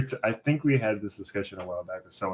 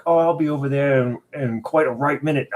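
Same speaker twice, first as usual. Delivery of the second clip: loud, higher imitation of an Irish friend; Irish accent; 4 semitones higher, 7 dB louder than usual. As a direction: belt it out in a mock Irish brogue. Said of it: "he would even text".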